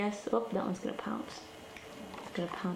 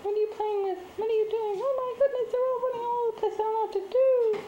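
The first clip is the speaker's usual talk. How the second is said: high, louder cooing at the cats; pet talk; 13 semitones higher, 3 dB louder than usual. Real, not acted. Talking to the mother cat in high pet talk. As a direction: coo at them way up high.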